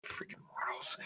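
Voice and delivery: whispered in-character grumble; hushed aside from an irritated character; grumbling to himself. Acted. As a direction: mutter it almost without voice, under the breath. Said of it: "Lear kinda shakes it off. He's".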